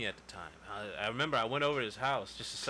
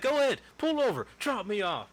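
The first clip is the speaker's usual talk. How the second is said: high, loud mock-angry demand; acted-out outburst, pitched way up; retold angry dare; 12 semitones higher, 6 dB louder than usual. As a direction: imitate a worked-up passenger daring the driver. Retold quarrel with the driver.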